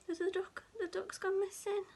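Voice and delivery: plain reading voice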